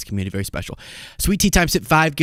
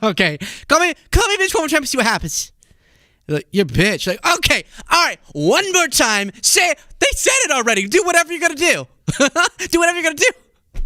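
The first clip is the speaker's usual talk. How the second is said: escalating hammed-up tough-guy threat, much higher; mock tough guy; 13 semitones higher, faster than usual. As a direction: play the bar tough, building up. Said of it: "And you're like".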